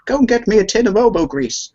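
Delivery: high playful granny voice; a hint of a grandmother's tease; granny's request. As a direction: lightly voice an old gran asking. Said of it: "the joke you used to say".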